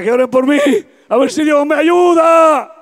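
high, loud pleading cry; telling his wife he needs prayer, high desperate plea. Retold or imitated. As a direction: beg for help at full voice. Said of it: "le dije a mi esposa".

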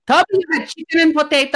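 caregiver voice. Retold or imitated. Caregiver reporting mom's lunch to Ryan, high and chirpy.

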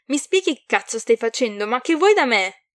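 loud, exasperated questioning; fed-up, forceful outburst; angry demand. A narrator's reading. Irate demand to late-night caller.

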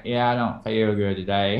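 low Southern drawl, imitating tired self; long Southern drawl. Showing how they sound when tired, long Southern drawl. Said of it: "words in a long Southern drawl".

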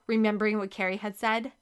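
ordinary delivery

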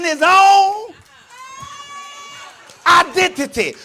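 loud, high shouted preaching; full-force preaching. Real, not acted. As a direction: shout the testimony to the whole church.

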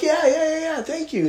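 very high, rapid-fire yeahs; high-pitched mock gratitude; eager acknowledging. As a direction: squeal out a relieved reply. Retold hurried reply to operator.

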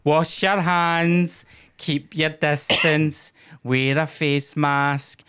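slow, high, loud patronising ad voice; mock ad voice. Mocking the covid ad presenter, patronising. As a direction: talk down like a nanny to children.

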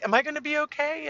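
put-on high, quick worried-child voice; a little upset. Daughter scared by mom's warning, high upset child voice. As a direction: imitate an anxious kid seeking reassurance. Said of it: "starts getting a little upset".

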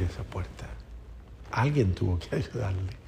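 whispered in awe; hushed aside. Acted. Preacher marvelling to listeners how she opened it, whispered. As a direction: drop to a whisper for the wonder. Awe at fragile girl opening door.

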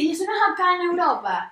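lightly voiced clueless questioner; clueless friend. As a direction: imitate a naive question.

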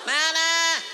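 high, hammy calling voice. Acted. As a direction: holler for mama across the house. Calling out for mama.

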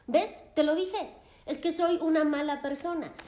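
a retold patient's bitter outburst; bitter self-blame. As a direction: spit out his own guilt like proof. Dean voiced in self-blame.

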